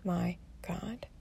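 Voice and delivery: slow breathless whisper of despair; barely voiced, drawn-out dismay; whispered oh my God. Retelling the doctor's office mix-up, whispered in despair. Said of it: "I'm like".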